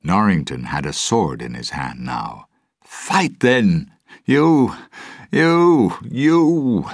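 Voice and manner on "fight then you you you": put-on loud, high-pitched drunken challenge; drawn-out, high drunken yelling; drunken shout. A narrator's reading. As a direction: shout it out like a drunk spoiling for a fight. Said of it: "he shouted drunkenly".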